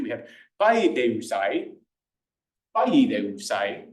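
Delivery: paideusai slow, rising at the end; slow classical paideusai; classical pronunciation. Shown as a demonstration. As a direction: drag out paideusai and lift it. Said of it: "I'll do a classical pronunciation".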